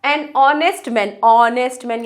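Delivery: honest drawn out starting on "o", no h; teacherly slow honest with the h dropped; silent h demo. Shown as a demonstration. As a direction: say honest slowly as "onest", vowel first. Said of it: "ऑनेस्ट बोल रहे हैं".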